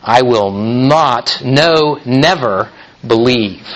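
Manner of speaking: slow, stressed "not" and "never"; emphatic refusal. Retold or imitated. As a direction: drag out "not", then hit "never" firmly. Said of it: "That's how strongly he said this".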